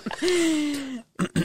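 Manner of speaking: high burst of laughter. Real, not acted. Laughs at bucket joke.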